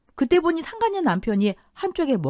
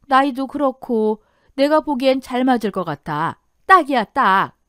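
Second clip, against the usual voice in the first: animated, emphatic neighbour; keen recommendation, ending loud; eager matchmaker friend; 2 semitones higher, 5 dB louder than usual. Acted. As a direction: pitch the perfect match to your friend.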